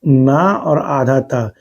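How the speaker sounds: आधा ता said slowly; teacher points out the half letter; slow explanation. Shown as a demonstration. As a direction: spell out आधा ता deliberately. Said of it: "ये भी आधा ता होगा".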